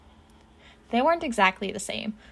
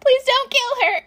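very high, loud wail; terrified, high-pitched plea; pleading wail; 7 dB louder than usual. A narrator's reading. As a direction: beg in a squealed panic. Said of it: "Izuku wails".

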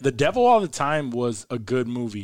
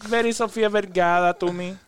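Sofía Vergara; 4 semitones higher than usual. Shown as a demonstration. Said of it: "very animated and talk like this".

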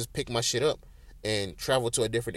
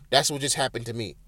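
normal talking voice

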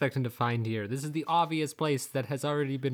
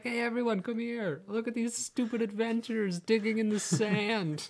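much higher mocking call to friends; jeering Bedin; 10 semitones higher than usual. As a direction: call the others over to laugh. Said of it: "just like".

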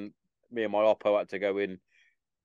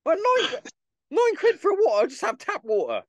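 much higher and louder, mock indignant squeak at the price; outraged disbelief; 5 dB louder than usual. Soldier reacting to a nine-pound bottle of water, much higher mock outrage. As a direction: voice jumps way up in comic shock.

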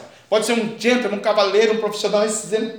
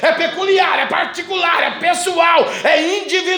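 louder, higher preaching build; climbing sermon cry; 3 semitones higher, 8 dB louder than usual. Acted. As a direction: hammer each line home. Preaching at full force.

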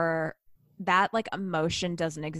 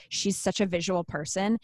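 usual speaking voice

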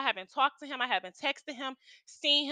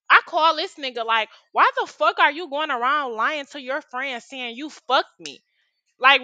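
angry rant; 2 semitones higher, 5 dB louder than usual. Real, not acted. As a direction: tear into him, fuming.

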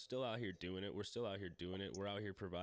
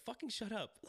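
much higher, shrill-ish mock of his wife cutting him off; mock wife snapping; 14 semitones higher than usual. Imitating his wife telling him off, pitched way up. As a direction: squeak it out like the annoyed wife. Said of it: "She's like".

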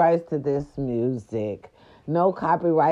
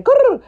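playful high growl; 8 dB louder than usual. Real, not acted. Signature growl.

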